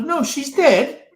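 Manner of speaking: high, slow, half-laughing English lady voice. Retold or imitated. Scout leader corrects the narrator's joke in a high, laughing English voice.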